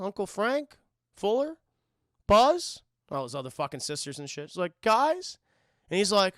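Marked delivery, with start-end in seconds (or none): 4.8-5.4 higher, louder call. Retold or imitated. Kevin calling for his family. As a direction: call through the empty house.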